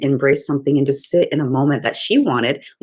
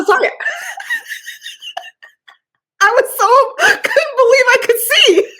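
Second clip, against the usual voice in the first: high, loud laugh-sob, giggling through tears; helpless laughing fit, eyes wet; laughing and crying; 4 dB louder than usual. Real, not acted. Laughing-crying fit after story.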